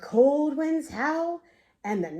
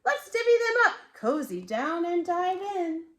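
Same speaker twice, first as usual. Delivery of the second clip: badger voice; 4 semitones higher than usual. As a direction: invite everyone to dig in, high and bright.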